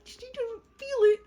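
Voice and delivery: high-pitched testy explanation; hammed-up defensive retort; mock exasperation. Healer snapping back at mother.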